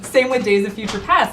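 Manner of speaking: ordinary talk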